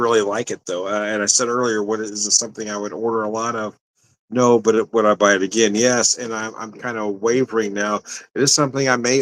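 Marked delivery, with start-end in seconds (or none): none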